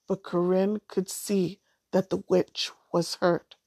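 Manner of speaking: ordinary delivery, nothing marked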